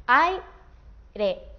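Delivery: «Aire» partido en ai-re, con pausa clara; separado por sílabas. Shown as a demonstration. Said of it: "lo haríamos así". («Aire» silabeado, ai... re)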